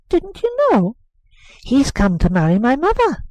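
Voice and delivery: put-on high teasing boy; boy telling nurse about his mother; boy's voice. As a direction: drop the news like a child with a secret. Boy revealing news.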